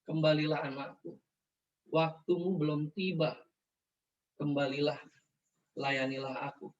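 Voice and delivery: low, soft, unhurried call; gentle, loving. Retold or imitated. God calling his child back, gently and lovingly. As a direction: speak tenderly, lowering the voice, pausing after each phrase. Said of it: "Suara itu lembut. Suara itu penuh kasih".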